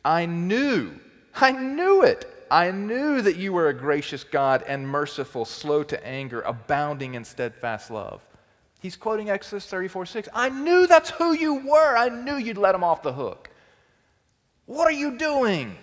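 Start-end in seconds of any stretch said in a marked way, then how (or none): 0.0-8.2 loud, exasperated complaint to God; indignant, raised voice; Jonah's angry outburst. Acted. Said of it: "he says this in Jonah four two". 10.3-15.8 shouted, much higher and louder; shrill accusing yell; furious Jonah. Acted. Jonah voiced raging at God.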